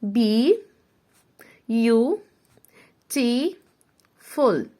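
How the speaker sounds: "بي يو تي فول" with long pauses; syllable by syllable. Shown as a demonstration. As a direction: spell "beautiful" out in beats. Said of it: "تعالوا نشوف".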